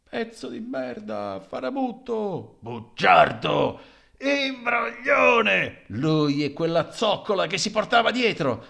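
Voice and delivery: loud, slow insults spat out; angry insults. Acted. A mourner hurling loud insults at the dead man. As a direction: hurl each insult at the coffin.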